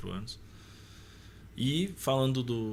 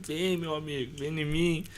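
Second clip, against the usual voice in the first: mock eager reply; 7 semitones higher than usual. Mock reply to friend's offer.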